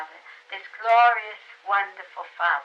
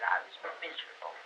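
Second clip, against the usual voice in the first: put-on a hushed, much lower little girl's voice, almost breath; miserable child; 10 semitones lower, 12 dB quieter, faster than usual. Little girl confessing to her teacher, sad hush. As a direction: let the child's misery drain the voice. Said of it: "she said".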